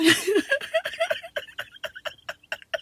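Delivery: squealing laughter; high helpless laugh. Real, not acted. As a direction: fall apart laughing, high. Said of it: "I'm laughing so hard".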